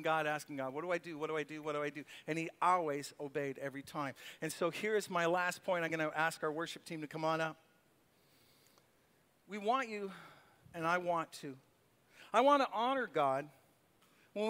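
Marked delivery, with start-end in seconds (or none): none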